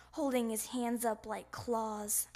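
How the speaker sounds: plain reading voice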